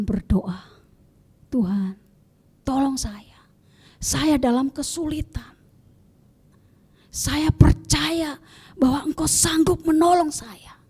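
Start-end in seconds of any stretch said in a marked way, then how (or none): 1.5-10.8 hushed, slow whispered plea; earnest prayer. Shown as a demonstration. Believer crying out humbly to God in trouble, whispered. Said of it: "Itu berseru".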